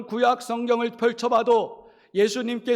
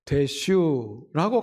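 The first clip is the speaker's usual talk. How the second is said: Chungcheong dialect; 7 semitones lower, much slower than usual. Shown as a demonstration. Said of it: "이 말을 충청도로".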